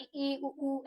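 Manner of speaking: इ, ई, उ, उ said softly with the mouth shut; quiet closed vowels; vowel demo. Shown as a demonstration. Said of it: "हमारा मुंह बिल्कुल बंद रहता है". (बंद मुंह से धीमे स्वर)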